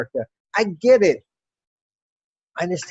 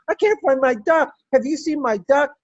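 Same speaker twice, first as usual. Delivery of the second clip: retold scream; 8 semitones higher than usual. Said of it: "I scream".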